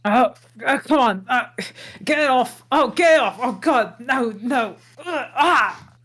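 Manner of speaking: high, loud panicked yelps; yelling in pain. Acted. Shrek yelps while a cat claws at him, high and frantic. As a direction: cry out as claws dig in. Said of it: "and Shrek screams in pain".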